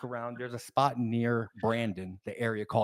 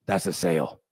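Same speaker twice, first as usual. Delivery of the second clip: low salesman; 7 semitones lower than usual. Retold or imitated. Imitates boss spotting a lit house.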